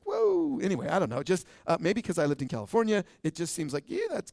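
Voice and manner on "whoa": a much higher, recoiling whoa; startled whoa. Retold or imitated. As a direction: pull back from it, mock shocked. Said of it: "It's just like".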